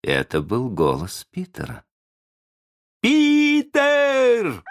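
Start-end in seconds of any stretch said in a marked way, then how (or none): 3.0-4.6 very high, loud, drawn-out call; ringing high-pitched yell; shout. A narrator's reading. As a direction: cry the name out like a crowd of boys. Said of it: "закричали мальчики".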